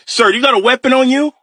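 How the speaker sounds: very high-pitched startled question; retold alarmed cop. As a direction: ask in a sudden high, alarmed voice. Cop alarmed as dad reveals weapon.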